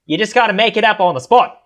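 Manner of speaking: put-on high, made-up sort-of-Aussie accent; improvised Australian accent. Co-host answers the challenge to make one, improvised Australian accent. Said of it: "is that your Australian accent?".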